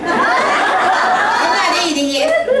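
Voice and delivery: laughing high through the words; chuckling out the age joke. Real, not acted. Old man laughing as he jokes about his age.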